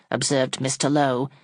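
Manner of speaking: usual speaking voice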